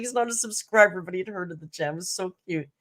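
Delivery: ordinary talk, nothing marked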